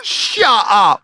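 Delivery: high, shouted disbelief; retold cry of amazement; shocked disbelief. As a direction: blurt it out, stunned. Retold disbelief at wedding news.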